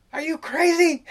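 high, loud mock disbelief; hammed-up outraged wife; imitating the wife yelling back at the skipper; incredulous wife. Wife protests skipper's plan.

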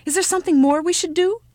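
high, loud, eager question; excited child. Acted. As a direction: ask it hopping with anticipation. Child dancing, begging to continue.

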